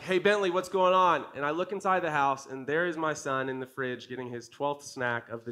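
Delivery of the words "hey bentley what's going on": louder call across the yard to his son. Retold or imitated. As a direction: call out to check on the kids. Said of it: "I'm like".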